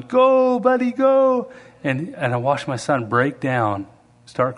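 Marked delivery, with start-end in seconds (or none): 0.0-1.5 high, loud cheer from the sidelines. Retold or imitated. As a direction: yell encouragement at your kid. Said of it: "parents are on the sidelines going".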